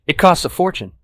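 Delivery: high, loud exasperation; exasperated. Acted. Dog owner on the pet's price, exasperated. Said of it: "then exasperated".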